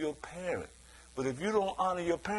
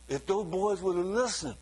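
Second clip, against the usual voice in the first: usual speaking voice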